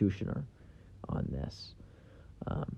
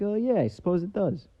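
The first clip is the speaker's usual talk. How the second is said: mock coworker; 9 semitones higher than usual. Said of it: "And he's like".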